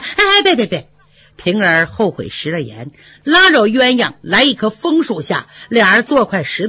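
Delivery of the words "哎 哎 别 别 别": quick high "别，别" to calm her down; hasty pleading. Acted. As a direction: stop her before she storms off. Ping'er hastily stops Yuanyang.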